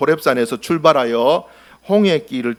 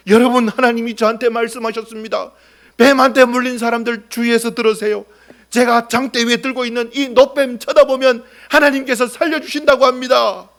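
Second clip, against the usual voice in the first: high, loud public announcement; proclaiming; 8 semitones higher, 3 dB louder than usual. Acted. Moses lifting the bronze snake before the people, proclaiming. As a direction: call out to the bitten crowd.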